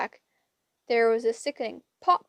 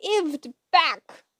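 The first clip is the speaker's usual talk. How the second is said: put-on old hag yelling with her mouth stuffed, louder and higher; 5 semitones higher, 6 dB louder than usual. A narrator's reading. Grey sister yelling at her sister to give it back. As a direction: shriek it through a full mouth.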